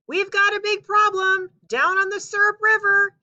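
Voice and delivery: loud, high shout calling up to them; yelling. A narrator's reading. Stranger yelling up to Paul and Babe, loud high shout. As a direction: holler up to the giants. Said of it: "he yelled".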